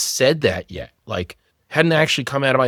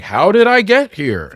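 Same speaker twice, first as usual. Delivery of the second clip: slow, raised-voice bewildered lyric imitation; 7 semitones higher, 5 dB louder, slower than usual. Man likens his divorce to a song, bewildered. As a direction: quote the lyric high, loud and drawn out. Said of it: "it was like the fucking Talking Head song".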